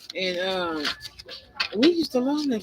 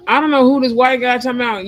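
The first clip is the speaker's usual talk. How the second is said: indignant outburst; 3 semitones higher, 7 dB louder than usual. Real, not acted. Calling out a stranger.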